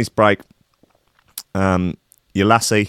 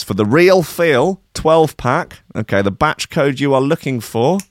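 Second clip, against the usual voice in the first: slow, high, drawn-out announcement; announcing the batch code like lottery numbers, mock-grand; lottery draw announcer; 5 semitones higher, 3 dB louder, slower than usual. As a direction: read it like a Saturday night lottery draw. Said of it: "like reading out lottery numbers".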